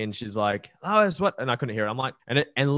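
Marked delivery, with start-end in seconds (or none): 0.8-1.3 high, loud mock call up the stairs; imitating partner's yell. Partner yells up from downstairs, imitated loud call. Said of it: "she yells out to me".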